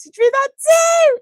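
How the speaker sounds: high, loud joyful shrieking; thrilled high squeals; excited squeal. Real, not acted. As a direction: greet a dear friend with delight. Gushing over friend's drawing.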